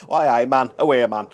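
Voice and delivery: loud, hammed-up Geordie accent. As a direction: throw on a Newcastle accent. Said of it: "Ree's from Newcastle".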